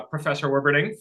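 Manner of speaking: ordinary talk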